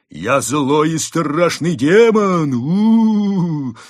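high, loud, drawn-out ghostly wail; mock-demon boast rising into a howl. A narrator's reading. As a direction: play a hammed-up scary demon. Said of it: "завыл он".

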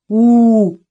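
loud hurt little "u" from a sulky bear; offended bear grunt. A narrator's reading. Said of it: "обиженно сказал медведь".

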